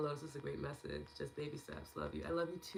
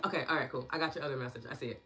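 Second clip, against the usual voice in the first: normal talking voice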